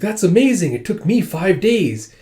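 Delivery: put-on high, loud, impressed little-kid voice; young child. Seven-year-old Minsu admires Suwon's quick reading, young child voice. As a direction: marvel like a seven-year-old, drawing out the end. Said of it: "seven years old".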